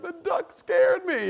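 put-on little girl voice. Father imitates his small daughter, high and slow. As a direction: squeak it like a scared four-year-old. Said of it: "she's like".

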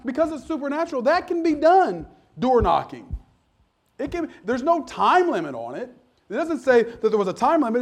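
high, loud, climbing preacher's cry; full-force revival preaching, rising and pounding; fiery preaching. Acted. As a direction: preach it hard and urgent to the crowd.

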